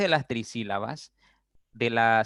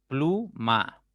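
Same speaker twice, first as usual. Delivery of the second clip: "Pluma" split into plu - ma; syllable by syllable; 2 semitones higher than usual. Shown as a demonstration. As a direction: say "Pluma" as two separate beats, plu, ma. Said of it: "ya tienen dos sílabas".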